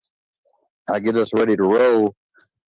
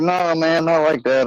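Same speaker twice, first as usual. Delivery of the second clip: defensive protest; 7 semitones higher than usual. Retold or imitated. Protesting a misread remark.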